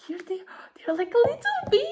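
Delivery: gushing over something tiny and cute. Real, not acted. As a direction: coo at them like a newborn. Cooing over tiny new earbuds.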